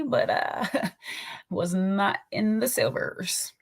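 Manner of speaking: hushed, slow whispered aside. Real, not acted. Whispering an aside to viewers about the concert. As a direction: drop to a giggly whisper for the viewers.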